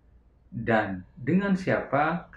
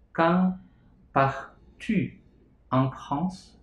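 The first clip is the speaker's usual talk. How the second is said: split drill; 4 semitones higher, much slower than usual. Shown as a demonstration. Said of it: "Misalnya kalian mau bertanya".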